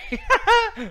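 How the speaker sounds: high, loud real laugh; sudden high-pitched cackle; burst of laughter. Real, not acted. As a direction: crack up at a silly joke. Laughs at the frogs joke.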